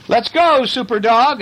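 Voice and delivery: high-pitched rallying call; excited urging. Acted. Girl urges her dog to do a trick, high excited call.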